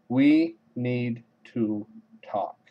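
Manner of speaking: very slow, spaced-out words; ominous announcement. Retold or imitated. Maria on the payphone to John, ominous slow announcement. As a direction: drop each word separately, with weight. Quotes Maria's dreaded phone line.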